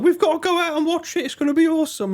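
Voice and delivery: mock hype. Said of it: "He's like".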